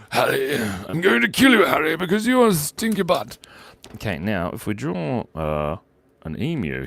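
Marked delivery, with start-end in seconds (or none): 0.1-3.4 Voldemort. Acted. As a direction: intone the silly threat like a dark lord. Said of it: "Do the Voldemort voice".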